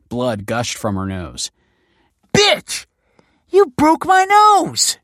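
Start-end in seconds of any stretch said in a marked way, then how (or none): 2.3-5.0 very high, loud pained yell. Acted. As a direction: scream it through the pain. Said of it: "she yelled".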